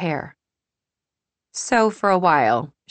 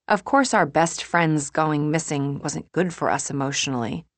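ordinary delivery

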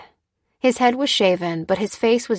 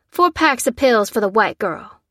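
raised, louder, curt order; sharp, higher-pitched command; snapping; 4 semitones higher, 5 dB louder than usual. A narrator's reading. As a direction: snap an order at an underling. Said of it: "snapped the woman".

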